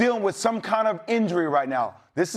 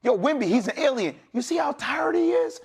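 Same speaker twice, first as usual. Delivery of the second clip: usual speaking voice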